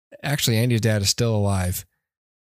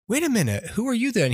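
higher, rising confusion; mock dawning shock; 6 semitones higher, faster than usual. Host voicing Buzz realizing his dad was killed. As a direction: act out the hero's growing alarm.

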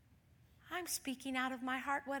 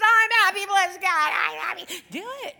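much higher, much louder joyful shout; screaming; 10 semitones higher, 17 dB louder than usual. Shown as a demonstration. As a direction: scream it out in triumph. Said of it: "screaming through tears".

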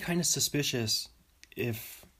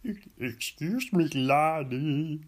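badly faked British accent, pitched up; a celebrity's hammed-up attempt at British; Charles Barkley; 3 semitones higher, 3 dB louder, slower than usual. Sports celebrity addressing a laddie, terrible British accent. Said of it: "Charles Barkley doing a British accent".

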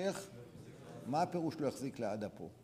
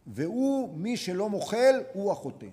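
loud, higher, slow condemnation; forceful declaration; 6 semitones higher, 9 dB louder, slower than usual. Real, not acted. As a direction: thunder the verdict from the pulpit. Preacher condemning the unforgiving.

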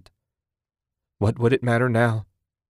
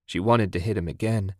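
ordinary delivery

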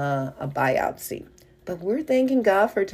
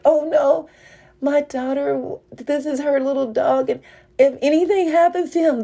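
fear for her daughter's lost dog, voiced in a rush; panicked thoughts; 8 semitones higher than usual. Chasing her daughter's dog toward the woods, panicked and high. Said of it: "these are the things I'm thinking in my mind".

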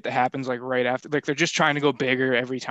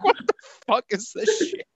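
higher mock-baffled outburst. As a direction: throw up your hands at the absurd scene. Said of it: "And it's like".

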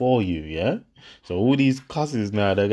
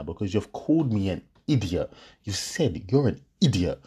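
ordinary delivery

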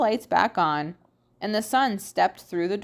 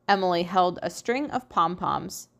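ordinary delivery

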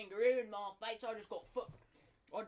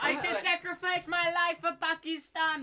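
put-on Pakistani accent; 7 semitones higher, 7 dB louder than usual.

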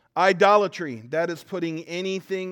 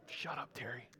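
deadpan brush-off; 5 semitones lower, 20 dB quieter than usual. Retold or imitated. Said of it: "he's like".

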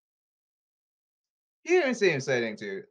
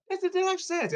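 mock disbelief; faster than usual.

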